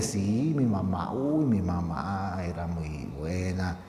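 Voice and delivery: slow, drawn-out mock gushing about mom; imagined fond gushing. Jesus imagined replying to praise of his mother, mock doting. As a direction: draw out "uy" and "mamá", pretending to dote. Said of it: "en lugar de decirle".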